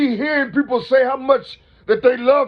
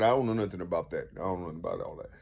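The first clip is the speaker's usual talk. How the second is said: much lower, mumbled put-on voice of someone brushing it off; mock dismissive voice; 13 dB quieter, much faster than usual. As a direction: drop low and mumble like a guy who doesn't care. Mocking reply voice.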